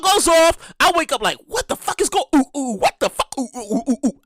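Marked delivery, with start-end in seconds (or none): 1.5-4.2 jolted awake, confused yelps; groggy panicked ooh-ooh; startled panic. Retold or imitated. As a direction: act out being shocked awake by an alarm. Woken by alarm, panicking.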